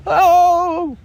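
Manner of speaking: high, loud startled yell; shout. A narrator's reading. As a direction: yell while falling. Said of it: "he shouted".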